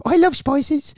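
very high mock footballer voice; put-on high voice, badly faked; David Beckham. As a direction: attempt a bad high-pitched celebrity impression. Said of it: "like, a really sort of high".

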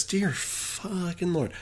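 ordinary talk, nothing marked

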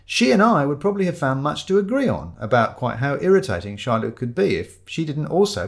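ordinary delivery

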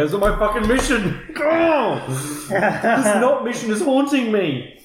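high-pitched, shouted comic protest; mock exasperated outburst. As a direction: wail the complaint in hammed-up outrage. Mock outburst over the mission.

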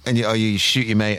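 ordinary talk, nothing marked

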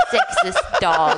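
laughing. Pretend. Mock insult laughing.

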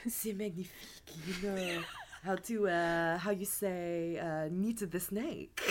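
put-on a French lover fumbling for English words, in French accent; mock French accent. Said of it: "for butchering your accent".

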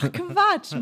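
high surprised exclamation. Real, not acted. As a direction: burst out in sudden surprise. Surprised realisation, pitch jump.

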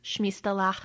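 playful Russian accent; fake Russian accent. Shown as a demonstration. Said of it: "Do that Russian accent again".

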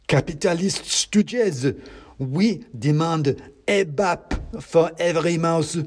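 high, slow, emphatic demand; worked-up mouse agitator. A narrator's reading. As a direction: declaim it as a little revolutionary getting heated. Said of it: "getting himself worked up".

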